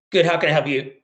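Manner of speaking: normal talking voice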